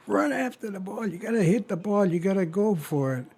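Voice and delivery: high, rapid exasperated nagging; retold infuriated outburst. George urging dad to chase the tennis ball, infuriated.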